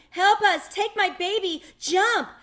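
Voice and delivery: high, loud desperate cries for help; panicked shouting. A narrator's reading. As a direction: scream it over the chaos of a sinking ship. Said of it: "Some were running and shouting".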